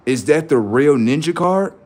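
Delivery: normal talking voice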